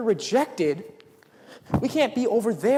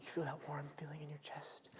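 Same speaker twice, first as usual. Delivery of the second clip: breathy mock-mystical whisper; mock dreamy whisper; 3 semitones lower, 19 dB quieter than usual. Preacher parodying the warm-chest feeling in a whisper. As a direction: whisper it as a cliché he rejects. Mocking expected spiritual feeling.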